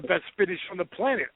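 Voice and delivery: normal talking voice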